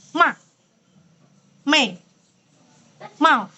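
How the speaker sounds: "Ma", "Me", "Mau", each short and sharply falling; firm fourth tone. Shown as a demonstration. Teacher giving examples of tone four, firm falling syllables. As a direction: fire off "Ma", "Me", "Mau" separately, each firmly dropped. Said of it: "Tegas. Dia tegas".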